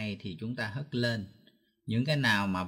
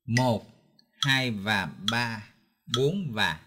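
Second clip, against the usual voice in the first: "một, hai và ba, bốn và" counted in time, "hai và ba" stretched; counting with the metronome; 2 semitones lower, slower than usual. Shown as a demonstration. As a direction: count along with the click. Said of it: "đọc theo máy đánh nhịp". (đếm "một, hai và ba, bốn và" theo máy đánh nhịp)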